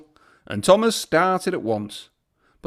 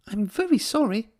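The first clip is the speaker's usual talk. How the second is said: apologetic engine; 8 semitones higher than usual. A narrator's reading. Thomas apologises.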